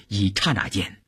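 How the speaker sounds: ordinary delivery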